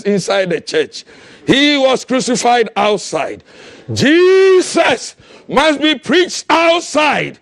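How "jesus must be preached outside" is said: higher, slow, ringing proclamation; slow preached declaration. Acted. Preacher declaring Jesus must go outside, ringing. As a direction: proclaim it slowly to the crowd.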